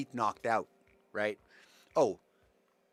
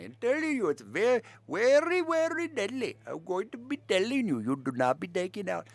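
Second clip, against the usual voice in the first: hammed-up Indian accent, higher and louder; mock Indian accent; 8 semitones higher, 3 dB louder than usual. Podcaster hamming up an Indian accent to mock covid rules. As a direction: overdo the Indian accent, pitch soaring on very. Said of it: "That's not Australian".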